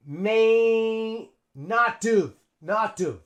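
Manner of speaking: "May" drawn out, then "not have" as "not-tuv"; linked pronunciation. Shown as a demonstration. Teacher shows how we say it, slow and linked. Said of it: "So here we say".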